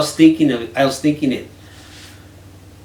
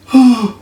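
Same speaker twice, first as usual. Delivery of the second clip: mock gasp; 11 semitones higher, 11 dB louder than usual. Hearing Rini's complaint.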